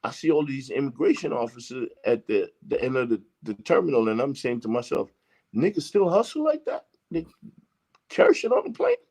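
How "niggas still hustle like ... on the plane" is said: high-pitched retold disbelief; incredulous inner voice. Traveller marvels to himself at immigration officers waiting, incredulous. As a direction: voice an amazed thought, pitched well up.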